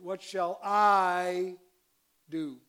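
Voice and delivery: slow reading, leaning hard on "I"; stress on I. Shown as a demonstration. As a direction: hit I loud and drawn out. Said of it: "I'll accent it".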